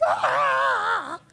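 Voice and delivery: high, loud mock panic shriek; mock scream. Father watching son ride one-handed, hammed-up shriek. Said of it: "I was like".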